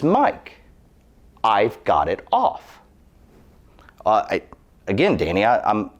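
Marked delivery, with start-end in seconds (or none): 0.0-2.8 insistent, fed-up retort; frustrated. Retold or imitated. Father-in-law losing patience with Mike, frustrated and insistent. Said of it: "getting frustrated now".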